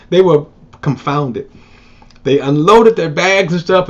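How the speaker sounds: ordinary delivery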